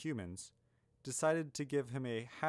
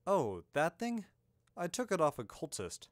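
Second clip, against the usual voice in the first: higher, louder offhand reply; casual, unconcerned dismissal; bland; 6 semitones higher, 5 dB louder than usual. A narrator's reading. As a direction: toss it off as nothing special. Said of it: "Milo said blandly".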